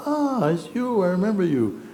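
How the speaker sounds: playful imitation of an excited tourist; delighted recognition. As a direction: gush like you've found a long-lost friend. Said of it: "she goes".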